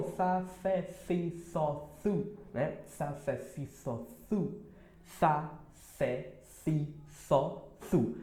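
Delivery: sa, sé, si, só, su with the tongue between the teeth; soft th syllable drill; lisped s drill. Shown as a demonstration. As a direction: drill the syllables with a lisp, softly. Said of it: "ele tem esse som".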